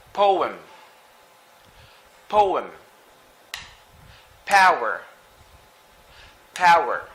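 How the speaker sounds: ordinary delivery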